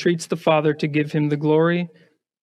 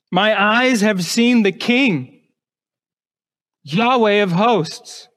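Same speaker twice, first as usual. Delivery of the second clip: slow awe; 3 semitones higher, slower than usual. Acted. Isaiah's awe quoted slowly.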